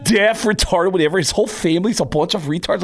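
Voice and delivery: dismissive drummer impression, high-pitched. Drummer waving off the correction, high dismissive impression. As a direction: brush it off in a high mock-drummer voice. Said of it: "He goes".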